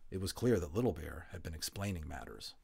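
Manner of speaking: plain reading voice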